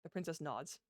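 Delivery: ordinary delivery, nothing marked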